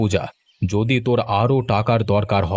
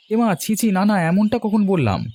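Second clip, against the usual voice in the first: flustered denial; 8 semitones higher than usual. Acted. Flustered denial to village head.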